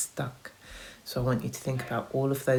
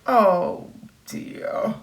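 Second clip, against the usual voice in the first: sigh; 6 semitones higher, 5 dB louder than usual. Acted. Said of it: "sighed Mister Wolf".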